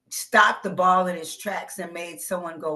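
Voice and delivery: ordinary talk, nothing marked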